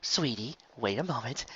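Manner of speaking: soft, tender calming; gentle plea. Acted. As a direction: coax her to wait. Lyra soothing.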